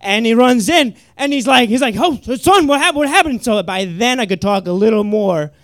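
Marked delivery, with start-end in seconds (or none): 2.0-3.4 high, loud alarmed father; worried dad. Retold or imitated. Dad bursting into the bathroom, loud and alarmed. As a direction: rush in calling out to your son.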